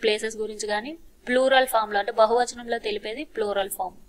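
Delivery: ordinary delivery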